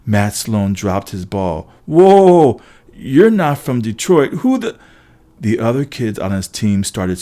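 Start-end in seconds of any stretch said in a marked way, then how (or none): 1.9-4.8 loud, high-pitched shock; startled alarm. Acted. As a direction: blurt it out, stunned by what appeared. Matt Sloan shocked by giants appearing.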